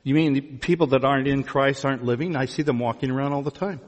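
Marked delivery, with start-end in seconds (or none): none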